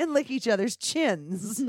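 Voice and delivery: high and loud through laughter; laughing. Real, not acted. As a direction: blurt it out mid-laugh. Joking with co-host.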